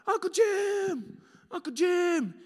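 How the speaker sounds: high, loud desperate calls for help; frantic shout from inside the hatch; panicked yelling. Retold or imitated. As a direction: yell his name, trapped and scared. Locked in, screaming for uncle.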